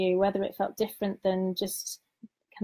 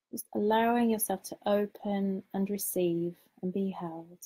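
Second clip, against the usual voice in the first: guided meditation. Acted. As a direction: lead them gently into calm, unhurried.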